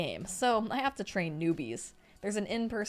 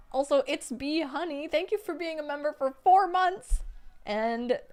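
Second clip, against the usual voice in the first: ordinary delivery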